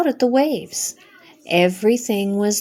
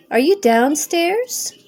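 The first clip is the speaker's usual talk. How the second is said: calling out; 7 semitones higher than usual. A narrator's reading. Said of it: "he cried".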